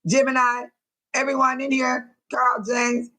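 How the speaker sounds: ordinary talk, nothing marked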